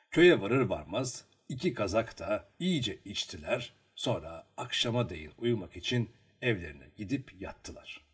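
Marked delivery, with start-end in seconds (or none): none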